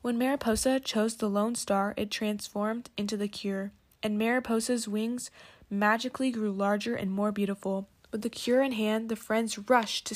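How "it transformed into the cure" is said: plain reading voice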